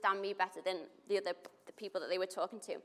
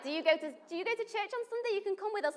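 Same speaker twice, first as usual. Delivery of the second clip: high, fast, laughing invitation; eager invite to church; 12 semitones higher, much faster than usual. Retold or imitated.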